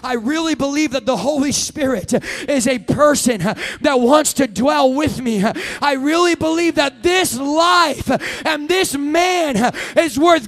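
slow, loud, rising shout of faith; preaching at full force. Acted. Preacher shouting his creed to the congregation, at full force. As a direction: preach it with all your breath to the room.

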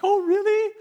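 high, loud "oh, really?"; excited disbelief. Retold or imitated. Surfer hearing the photo was taken, excited disbelief. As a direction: react with delighted surprise.

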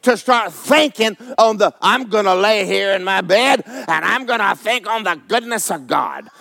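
high, emphatic pulpit delivery; fervent, insistent preacher; full-force preaching. Real, not acted. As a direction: drive each question and answer home to the congregation.

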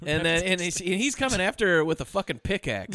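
ordinary talk

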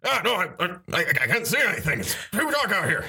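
high, bewildered blind captain; confused captain. Acted. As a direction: grope for answers.

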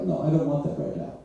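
finicky cat. Retold or imitated. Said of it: "the cat is like".

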